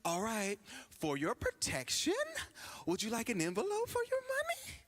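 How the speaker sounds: a light polite-teller voice, rising high at the end; mild mock bank-teller offer. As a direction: mimic the overly courteous teller. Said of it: "you know what they gonna tell you".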